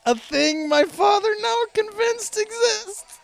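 squealing high, slowed by laughter; really cracking up, voice way up high; helpless laughter. Real, not acted. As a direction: struggle to read through a laughing fit. Reads on through helpless laughter.

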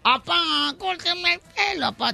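voz aguda y lenta de niño suplicando; niño insistente. Acted. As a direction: como un niño chiquito que ruega a su papá. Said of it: "Llega un niño de cinco años". (niño de cinco años rogando, voz aguda)

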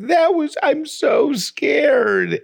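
put-on high, slow, tiny scared baby voice. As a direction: squeak it out like a frightened toddler. Voicing scared baby daughter.

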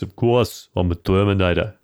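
lightly voiced Arnie with an Australian accent; Aussie Arnie. Friend voicing Arnie in an Australian accent. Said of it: "the Aussiest Arnie I've ever done".

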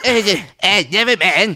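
half Cartman. Retold or imitated. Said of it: "like doing a half Cartman".

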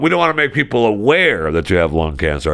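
ordinary talk, nothing marked

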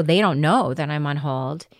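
ordinary talk

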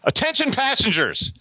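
high, slow, drawn-out conductor call; train announcer. Acted. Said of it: "for the keeping it up segment".

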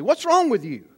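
high-pitched mock scolding; stern. Boy scolds the classmate who tripped, stern. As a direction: act out a boy sternly telling someone off. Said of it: "he says to her sternly".

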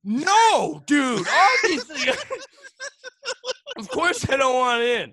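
loud, high mock outrage with laughter; imitating an appalled buddy; emphatic retold refusal. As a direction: refuse loudly, as if it's obvious. Acting buddy's refusal after "He goes.

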